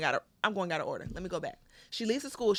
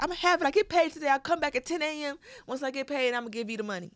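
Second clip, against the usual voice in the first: pleading mother; 8 semitones higher than usual. Retold or imitated.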